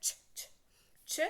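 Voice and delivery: unvoiced whispered ch, ch; breathy train-like ch repeated; ch sound demo. Shown as a demonstration. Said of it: "Try this first sound of C H".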